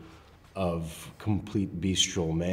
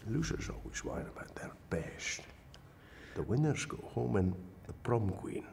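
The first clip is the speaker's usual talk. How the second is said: Sean Connery impression; 4 semitones higher, 6 dB quieter than usual. Quoting Sean Connery's line from The Rock in a Scottish accent. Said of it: "That's from The Rock".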